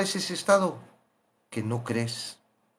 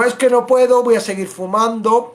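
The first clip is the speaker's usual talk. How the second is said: higher, louder complaining excuse; mock whiny excuse; 7 semitones higher, 7 dB louder than usual. Smoker saying he will keep smoking, loud mock whine.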